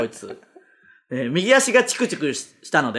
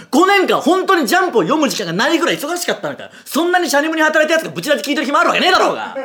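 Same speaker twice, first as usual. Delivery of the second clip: high-pitched, mounting mock-furious tirade; comic ranting; 8 semitones higher, 3 dB louder than usual. Host ranting at a too-busy listener, comic mock fury. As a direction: hammer the listener with exasperated questions. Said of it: "皆さんの失敗を即興でのしる".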